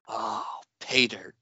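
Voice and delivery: "pay dirt" drawn out, weight on "pay"; slow exclaimed "Oh", then PAY stressed and dirt falling; script's exclamation, stress on PAY. Retold or imitated. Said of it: "and exclaim".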